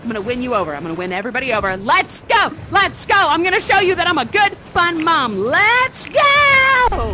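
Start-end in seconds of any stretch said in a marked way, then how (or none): 1.8-7.1 building from louder cheers to a much higher, louder final "Let's go"; rallying shout. Acted. To the kids she wants to win over, a building rally shout.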